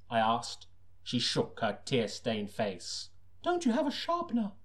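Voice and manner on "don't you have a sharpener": much higher falsetto woman; hammed-up falsetto lady; young woman voice. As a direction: squeak it up high like the client. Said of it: "she replied".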